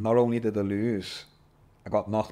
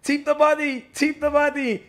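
loud corner shout, much higher; coach shouting; 8 dB louder than usual. Retold or imitated. Coach yelling instructions mid-fight.

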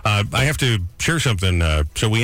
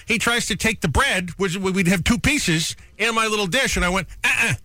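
guard your bread like a grumpy diner; mock stern warning; 7 semitones higher than usual. Telling the busboy off over the bread, testy and higher. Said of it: "he said it just like that to this poor kid".

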